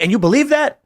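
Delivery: sharp high rise of doubt; incredulous challenge. Retold or imitated. Coworker challenging the young atheist, high and incredulous.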